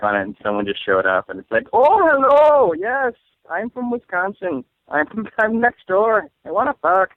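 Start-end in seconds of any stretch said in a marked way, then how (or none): 1.7-7.1 high, loud hammed-up Irish accent; a hookup ad girl in a botched Irish accent; bad Irish accent. Pop-up ad lady from Wisconsin, hammed-up Irish accent. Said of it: "my Wisconsin accent is Irish".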